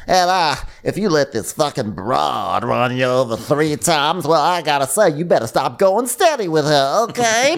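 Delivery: high, hammed-up fifties teen, climbing to a squeal; nineteen-fifties talk. Warning a friend off a date, mock fifties teenager. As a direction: stretch it like an old-movie sweetheart. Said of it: "talking like she's from, like, nineteen fifty-seven".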